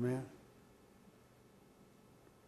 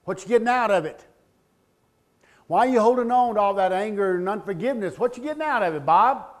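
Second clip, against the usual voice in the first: loud, pressing questions, pitched up; forceful challenge; 8 semitones higher, 11 dB louder, faster than usual. Retold or imitated. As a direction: hammer the questions at him. Therapist confronting him, retold loudly.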